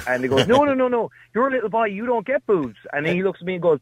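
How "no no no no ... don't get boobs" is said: high-pitched mock parental protest; correcting parent. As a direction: lightly act out putting a child right. Parent correcting little boy, retold.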